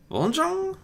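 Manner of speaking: high, puzzled question; baffled by the strange word; young dragon puzzled by a name; confused. A narrator's reading. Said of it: "he asked confusedly".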